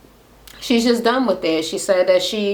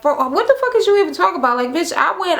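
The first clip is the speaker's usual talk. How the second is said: higher-pitched, quick imitation of an outraged retort; mock indignant comeback; 9 semitones higher, faster than usual. Carly answers Jocelyn's attack, imitated indignantly. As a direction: fire it back like an affronted accuser.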